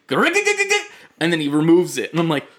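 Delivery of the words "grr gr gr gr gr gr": high, rattling mock engine noise; imitated engine sputter. The car failing to start, a high rattling imitation. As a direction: sputter like a dying starter motor. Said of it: "all you hear is".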